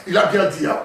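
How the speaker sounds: ordinary talk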